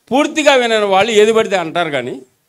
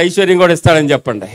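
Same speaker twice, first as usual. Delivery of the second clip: normal talking voice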